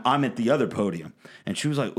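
ordinary delivery

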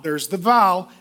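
ordinary delivery

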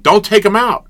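high, loud retold begging; frantic plea. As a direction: plead at the top of your voice.

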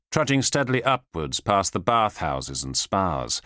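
ordinary delivery

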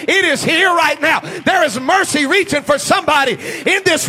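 loud, high fiery preaching, near shouting; full-force preaching. Acted. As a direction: preach it to the back row with fire.

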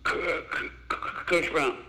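a hint of a shy stammer; halting, breathy start like a nervous teen; lightly voiced nervous boy; stuttering kid. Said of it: "And he stuttered".

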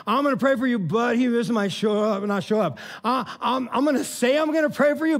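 high, loud mock excuse-making; insincere believer. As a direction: act out a hollow promise to pray. Voices an insincere promise to pray.

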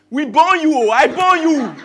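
loud, high, laughing exclamation; proud outburst. Real, not acted. Father laughing loudly about his son's birth, excited. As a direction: burst out with proud delight.

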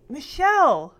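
calling out. A narrator's reading. Joey calls Michelle from downstairs, calling out. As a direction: call a name up to someone upstairs. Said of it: "Joey called up the stairs".

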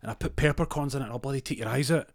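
high angry threat. Retold or imitated. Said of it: "He goes".

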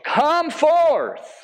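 slow name, then a high ringing command; loud cry. Retold or imitated. Jesus calls the dead man from the tomb, crying out. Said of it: "he cries out in a loud voice".

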